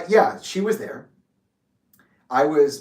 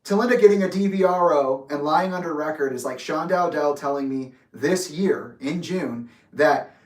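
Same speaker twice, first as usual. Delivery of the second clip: usual speaking voice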